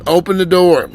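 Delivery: loud call over a car loudspeaker; over the patrol car speaker. Pretend. Officer in his patrol car calls out over the speaker, loud. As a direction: boom it out through the car speaker. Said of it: "on the loud, on the speaker".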